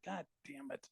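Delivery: soft rueful laugh through the words; a quiet, defeated chuckle of a curse. Retold or imitated. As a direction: laugh it out under your breath, caught. Rueful reaction to church basement meeting.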